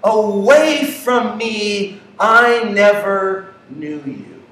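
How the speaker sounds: slow, deliberate words of judgment; slow, weighty rejection; slow verdict. Retold or imitated. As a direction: draw out each word like a final sentence.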